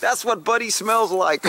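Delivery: a playful, hammed-up reveal; mock disgust. Imitating a viewer smelling the skunk towel, mock disgust. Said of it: "And you'll be like".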